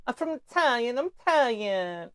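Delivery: high, whiny Italian imitation; hammed-up whining, stretched vowels; mock Italian whine. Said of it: "Italians always just whine when they talk".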